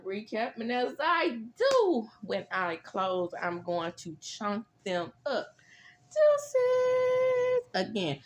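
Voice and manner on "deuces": high, loud farewell; signing off. Real, not acted. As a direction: toss a sassy goodbye. Signing off the video.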